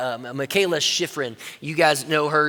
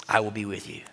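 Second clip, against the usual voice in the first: much lower and softer, unhurried; deep, quiet divine promise; low, hushed voice of God; 9 semitones lower, 7 dB quieter than usual. Acted. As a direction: answer calmly from above. God's response.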